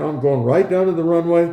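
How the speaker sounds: ordinary talk, nothing marked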